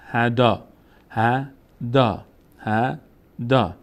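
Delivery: هدا drawn out very slowly, alef as long آ; teacher sounding out هدا slowly; spelled-out reading. Shown as a demonstration. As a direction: stretch هدا syllable by syllable.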